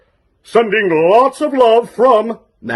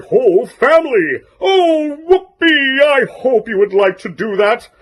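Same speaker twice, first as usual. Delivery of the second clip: high, singsong cartoon shark; sing-song voice for a small child; Daddy Shark voice; 4 semitones higher than usual. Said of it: "he's Daddy Shark".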